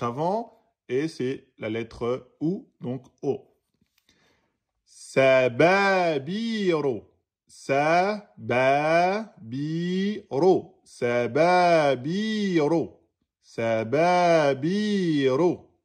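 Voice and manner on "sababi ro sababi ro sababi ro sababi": very slow "Sababi" with the final i held for two beats; two-beat vowel. Shown as a demonstration. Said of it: "deux temps".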